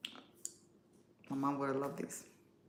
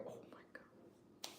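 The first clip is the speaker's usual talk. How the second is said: much softer, slow whispered disbelief; awed whisper; 29 dB quieter, slower than usual. Real, not acted. Creator whispering in disbelief at a realistic video. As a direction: breathe it out in shock.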